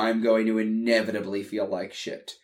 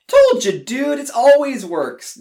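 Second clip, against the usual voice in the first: much higher, louder, slow told-you-so; smug boast; 12 semitones higher, 8 dB louder than usual. Acted. Chris gloating to his brother about the wine, high and loud. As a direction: crow it like you were right all along.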